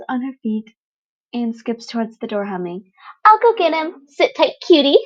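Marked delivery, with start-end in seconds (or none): 3.3-5.0 high, bright, giggly and loud; gleeful teen villain chirping at the wounded boy; bubbly villain girl Toga. Acted. Toga chirps to Izuku, high and gleeful.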